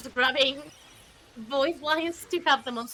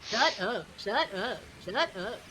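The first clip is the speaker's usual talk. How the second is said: deadpan Sol impression; 3 semitones lower, 3 dB quieter, slower than usual. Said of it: "in this game's case".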